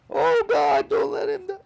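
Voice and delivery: a sudden high, loud plea to God; desperate prayer. Retold or imitated. A boy on the floor begging God for his friend, desperate loud plea.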